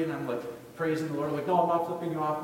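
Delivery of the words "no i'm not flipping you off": louder, higher hurried disclaimer; mock-earnest reassurance, voice raised; comic calling out. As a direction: call it out to another driver. Calls to another driver, joking.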